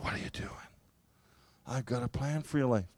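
soft, hushed and kind; barely voiced, tender insistence; compassionate whisper. God calls to him a third time, hushed and compassionate. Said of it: "it was so compassionate".

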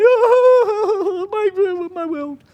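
high, tearful wail of a desperate mother; crying out. Retold or imitated. Hagar weeping in the desert, high tearful wail. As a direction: let the words break into a sobbing cry. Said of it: "and she's crying".

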